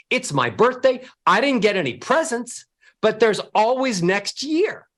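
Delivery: cheerful demo with ascending melody; happy ascending voice. Shown as a demonstration. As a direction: lift every phrase up with joy. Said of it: "Ascending scales".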